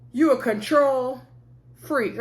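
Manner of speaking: "control" drawn out, a gap, then "freak"; imitating split-up phrase. Mimicking Bernard's insult to Marcel, "control ... freak" split apart. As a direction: say "control" and "freak" as two separate words. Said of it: "the way he separated the word control freak".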